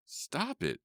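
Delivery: very high, contained scream. A narrator's reading. Worker begging the howling animals to quit, screaming. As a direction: scream high without real volume. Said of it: "I screamed".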